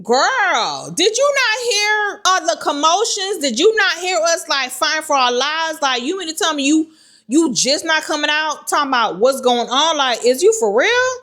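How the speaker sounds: high-pitched disbelieving telling-off; incredulous scolding. Retold or imitated. Scolding a friend who missed the whole commotion, incredulous.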